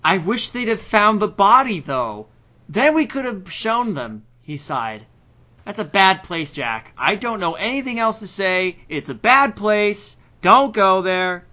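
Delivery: loud, high infomercial pitch; TV pitchman. Acted. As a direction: sell it like a shouting TV ad man. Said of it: "End of commercial".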